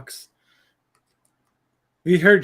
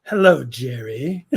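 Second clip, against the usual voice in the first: slow, drawn-out mock George Costanza greeting; a lightly voiced George Costanza, slow; George Costanza impression; slower than usual. Friends joking about audiobook narrators, in a slow mock George Costanza voice. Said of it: "George Costanza reading".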